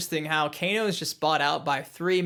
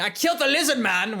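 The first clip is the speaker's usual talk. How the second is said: higher-pitched movie-line imitation; raised, hammed-up character voice; Kano; 7 semitones higher, 3 dB louder than usual. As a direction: quote the villain's boast in a put-on voice. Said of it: "we get a line from Kano saying".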